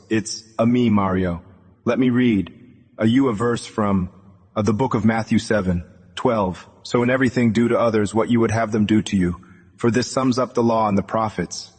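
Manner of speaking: usual speaking voice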